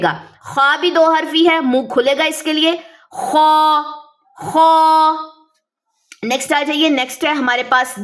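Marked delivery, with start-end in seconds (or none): 3.1-5.1 خو full-mouthed, tongue back raised; heavy خو; heavy letter. Shown as a demonstration. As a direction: fill the mouth and rasp خو from the back. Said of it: "آواز آپ کے منہ کے اندر trap رہے گی".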